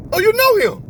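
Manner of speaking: high, loud talking-dog voice; puzzled dog. Retold or imitated. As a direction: voice a dog suddenly catching on. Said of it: "he looking like".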